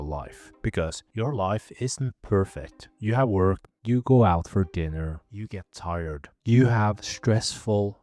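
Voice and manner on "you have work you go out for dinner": normal talking voice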